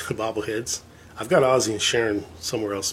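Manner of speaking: ordinary talk, nothing marked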